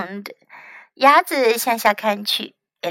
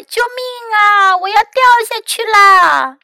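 panicked cry; 10 semitones higher, 4 dB louder than usual. A narrator's reading. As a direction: wail loudly in alarm. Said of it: "然后他就叫了起来".

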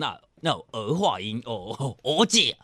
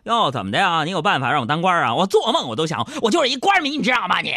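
兴奋的媳妇; 5 semitones higher, 4 dB louder, faster than usual. Retold or imitated. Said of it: "就问我".

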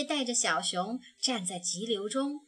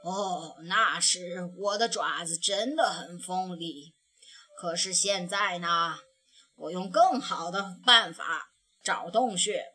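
put-on lower, steady voice of a wise old bear; old grandpa bear; 5 semitones lower than usual. A narrator's reading. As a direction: speak like a calm old grandfather. (熊爷爷对小熊慢慢说，低沉温和)

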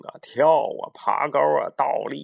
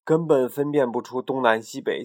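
plain reading voice

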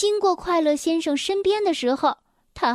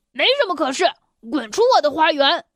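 grumpy retort; much faster than usual. Acted. Grumpy Mr. Grumpy shouting at Mr. Happy to leave. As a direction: chase him off, fast and cross. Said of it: "暴躁先生不依不饶地说".

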